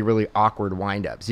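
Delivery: ordinary delivery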